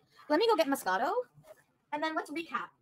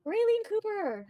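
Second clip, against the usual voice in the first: laughing sing-song name; 10 semitones higher, slower than usual. Real, not acted. Laughing greeting to viewer.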